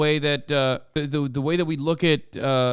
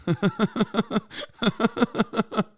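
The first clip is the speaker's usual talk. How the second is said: high, rapid laughter; high-pitched laughing fit; 5 semitones higher, 4 dB quieter than usual. Real, not acted. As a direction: burst into helpless laughter at something absurd. Laughing at the clip's claim.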